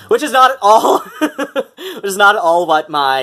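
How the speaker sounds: laughing while talking. Real, not acted. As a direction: giggle through the words. Laughing through words.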